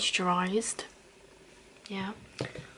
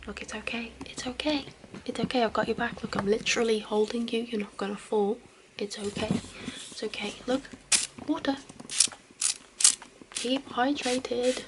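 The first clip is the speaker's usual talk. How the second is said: caring roleplay. Acted. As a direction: gently coax a drunk friend to drink water. Caring for a drunk friend.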